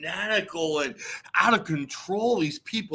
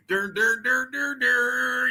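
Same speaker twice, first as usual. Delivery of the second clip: siren imitation; 5 semitones higher, slower than usual.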